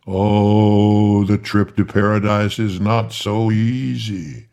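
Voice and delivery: loud, booming archangel voice; thundering archangel. Acted. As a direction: thunder it from above. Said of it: "still thundering in the voice of the archangel".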